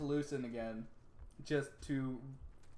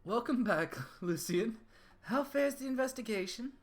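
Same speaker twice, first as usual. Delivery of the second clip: higher, louder courteous greeting; formal NPC voice; 9 semitones higher, 5 dB louder than usual. Acted. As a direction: welcome him back like a noble ally.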